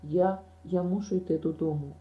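ordinary delivery

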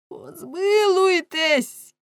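very high, loud drawn-out wail; desperate wailing plea; screaming for mercy. A narrator's reading. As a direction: cry out in agony, stretching it long. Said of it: "кричав рибник".

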